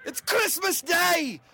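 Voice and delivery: high, slow ecstatic shout; overjoyed shout. Acted. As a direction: burst with delight. Overjoyed on Christmas.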